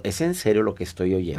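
low, subdued, unimpressed question; quiet disbelief. Acted. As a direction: ask it softly, as if you can't believe your ears. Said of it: "como diciendo".

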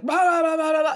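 high, loud mock auction babble; trading-floor clamor. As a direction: imitate frantic rapid-fire bidding calls. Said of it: "It looks like the trading floor".

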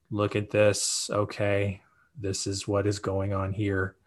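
mock boring presenter reading verbatim; boring slide reading. Shown as a demonstration. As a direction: drone through the slides like a dull webinar. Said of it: "but that's boring".

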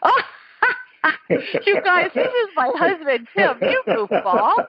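high-pitched, talking through delighted laughter; laughing in surprise. Real, not acted. Host outing her husband as the surprise caller, laughing. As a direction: crack up as the prank dawns on you.

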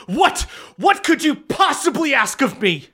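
high, shrill-edged outraged lady, shouting; outraged muddy noblewoman snapping back; indignant noble lady. Acted. GM voices muddy furious Whitlock.

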